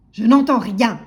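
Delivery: very loud, forceful holler; grocer's yell. A narrator's reading. Grocer dismissing timid customer, yelling. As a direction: bellow it at the customer. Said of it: "hurlait l'épicier".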